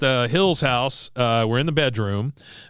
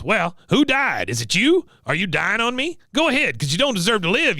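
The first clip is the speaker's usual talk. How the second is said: high-pitched goading taunts; hammed-up scolding, voice pushed up high; mock berating; 8 semitones higher than usual. Veteran berating struggling Peggy in retelling.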